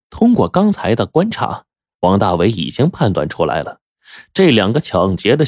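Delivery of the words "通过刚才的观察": ordinary delivery, nothing marked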